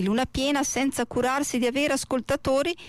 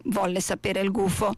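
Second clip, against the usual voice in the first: ordinary delivery, nothing marked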